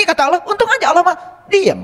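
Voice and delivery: ordinary delivery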